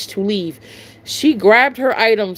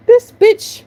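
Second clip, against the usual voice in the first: higher, louder disgusted aside; annoyed scoff; 11 semitones higher, 5 dB louder than usual. Retold or imitated. Cashier scoffing at a rude customer, disgusted.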